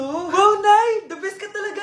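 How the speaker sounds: loud, high delighted cry over a present; thrilled. Acted. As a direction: squeal with joy at the gift. Child thrilled by gift. (tuwang-tuwang sigaw)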